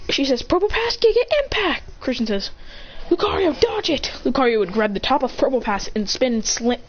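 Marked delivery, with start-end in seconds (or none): 0.4-1.8 high, excited move call; battle command. Acted. Olivia ordering Probopass to attack, high battle call. Rival trainer calls an attack. 3.1-4.1 high urgent call; battle command. Acted. Trainer warning Lucario mid-battle, urgent high call. Trainer warns his Pokémon.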